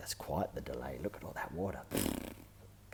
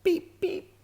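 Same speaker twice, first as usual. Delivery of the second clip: very high, loud giggly beep beep; giggling beep; 11 dB louder than usual. A narrator's reading. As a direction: giggle out the horn sound like a toddler. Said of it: "giggles George".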